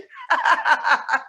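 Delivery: high real laugh; burst of laughter. Real, not acted. Laughing at her own dog joke. Joke about taping dogs.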